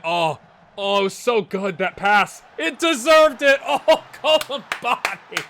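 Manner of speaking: loud, high, drawn-out cheer; excited match commentary. Real, not acted. Gamer thanks commenter Paul Colomani after a great pass, excited shout.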